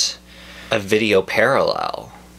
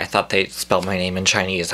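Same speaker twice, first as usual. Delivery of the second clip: ordinary delivery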